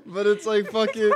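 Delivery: real giggling mid-sentence; talking through laughter. Real, not acted. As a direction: try to go on while cracking up. Laughing over own failed accent.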